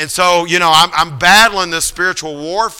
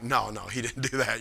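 quiet disbelief; 4 semitones lower, 12 dB quieter than usual. Retold or imitated. His doubting reply to himself.

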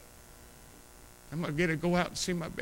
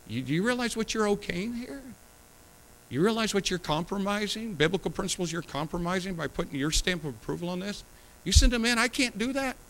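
higher, rising, pressing plea; pleading urgency; 6 semitones higher than usual. Retold or imitated. Pastor pleads with a parent over compromise, pitched higher. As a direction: press the parent to see what they're okaying. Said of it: "I'll come talk to you and I'll say".

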